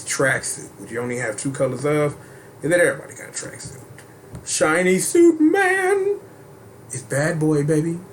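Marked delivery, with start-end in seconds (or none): 4.4-6.2 high, slow, drawn-out announcer call. Pretend. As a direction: stretch it out like a ring announcer. Announcing costume name.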